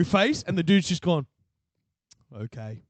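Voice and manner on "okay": low and very soft, imitating; meek retold reply. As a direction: give in under your breath. Chastened son's reply, retold.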